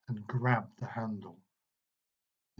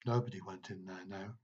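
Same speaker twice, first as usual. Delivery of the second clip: ordinary delivery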